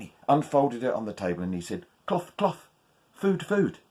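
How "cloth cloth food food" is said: higher hopeful spell; magic words. Acted. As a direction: say it expectantly. First try of spell.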